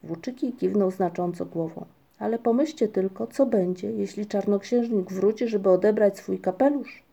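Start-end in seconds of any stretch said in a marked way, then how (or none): none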